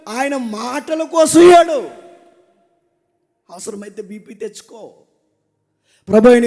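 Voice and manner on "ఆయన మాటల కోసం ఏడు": loud, slow, higher preaching shout over music; slow shouted climax. Acted. Preacher's climax calling the men to weep for God, shouted slowly. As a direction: build the altar call, each line slower and louder.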